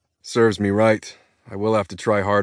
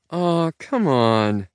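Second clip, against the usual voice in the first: a light chiding drawl, slow and a bit higher; mild chiding; 6 semitones higher, much slower than usual. Acted. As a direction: gently scold him, drawn out. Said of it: "she chides".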